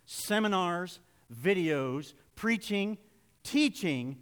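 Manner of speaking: one item at a time, paused between; reading slow. Shown as a demonstration. Preacher reading slow for the one fixing it, spacing out each item. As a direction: set each word apart in a measured list. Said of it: "I'll read slow".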